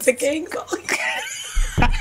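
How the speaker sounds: high, slow laughing cartoon voice; SpongeBob voice. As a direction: squeal it like SpongeBob. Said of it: "he was like".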